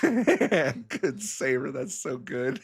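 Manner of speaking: high, helpless laughter through the words; real belly laugh, words squeezed out; laughing hard. Real, not acted. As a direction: crack up while praising the shot. Cracking up at the table play.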